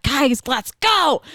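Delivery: mock-angry rallying of the kids; pissed off. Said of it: "I'm just fucking pissed".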